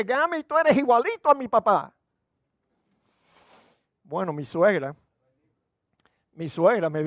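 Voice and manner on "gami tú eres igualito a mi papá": high, loud indignant voice; angry daughter. Retold or imitated. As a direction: shout it like an offended daughter. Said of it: "hija cuenta que enfrentó al novio". (hija furiosa, voz aguda y fuerte)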